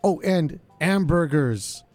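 ordinary delivery

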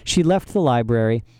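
ordinary delivery

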